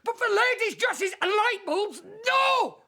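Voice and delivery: high frantic shouted refusal; shouting. Acted. Husband raging about his wife's demands, shouting. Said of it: "Stop shouting!".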